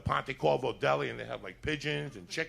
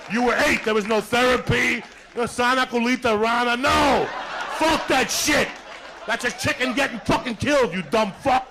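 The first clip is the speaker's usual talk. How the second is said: shouting rant; 9 semitones higher, 5 dB louder than usual. Real, not acted. Ranting about toughness as a kid.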